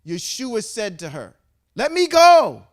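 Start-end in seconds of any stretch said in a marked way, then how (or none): 1.7-2.7 loud, high cry of "Let me go."; preacher crying out Jesus' words to the woman; loud plea. Acted. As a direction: throw the line out high and loud. Preacher voicing Yeshua's plea.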